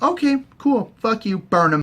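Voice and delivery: high, breezy mock queen voice; mock Dany. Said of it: "And then Dany's like".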